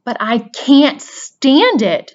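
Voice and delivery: slow, high, loud despairing shout. A narrator's reading. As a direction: cry it out in anguish. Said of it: "shouted Wilbur".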